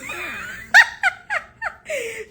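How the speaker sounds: very high shrieking laugh; real shriek of laughter. Real, not acted. Laughing at being coached.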